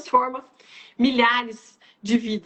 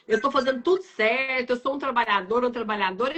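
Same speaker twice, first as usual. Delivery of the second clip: ordinary talk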